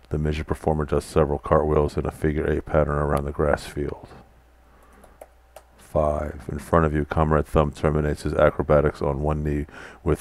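steady storyteller reading of game text; story narration. A narrator's reading. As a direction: read the scene out like a narrator. Reads the game's room text.

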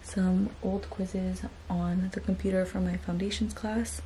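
hushed whisper so nobody wakes; whispering softly at night. Real, not acted. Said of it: "I have to whisper because everybody in my house is sleeping".

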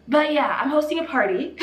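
excited. Real, not acted. Cheerful switch after crying.

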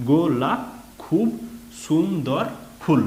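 slow, syllable by syllable, গোলাপ as গো-লাপ, সুন্দর as সুন-দর; broken-up reading. Shown as a demonstration. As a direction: read গোলাপ খুব সুন্দর ফুল piece by piece. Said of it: "ভেঙে ভেঙে বুঝিয়ে দিয়েছি".

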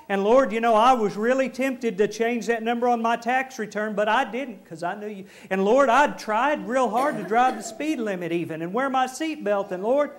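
hammed-up pleading with the Lord; mock pleading to God. Churchgoer pleading good deeds to God, hammed-up.

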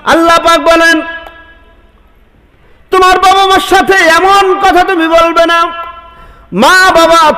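high, ringing sermon cry; fiery preaching. Acted. Preacher calling to the congregation, fiery and high. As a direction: preach it out to the crowd at full force. Preacher rises to full force.

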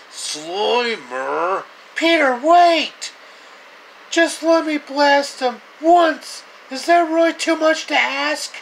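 very high, loud frantic pleading; frantic Ghostbuster. Retold or imitated. Ghostbuster begging Peter to let him blast Slimer, high frantic yell. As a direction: beg like a cartoon kid desperate to shoot.

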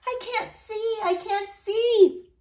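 put-on high child's voice, second time slow; small girl complaining, high-pitched; child shouting. A narrator's reading. Fallen girl in the crowd, high whiny cry. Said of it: "I shouted".

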